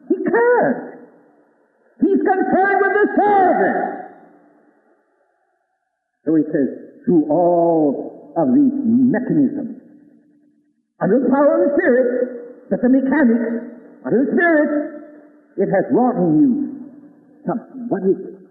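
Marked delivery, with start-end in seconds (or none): 0.1-3.8 preaching climb, much higher; fervent high emphasis on the servant; rising excitement. Real, not acted. 7.0-17.9 high fervent quoting voice; fervent sermon quoting of the Lord; preaching at full force. Acted.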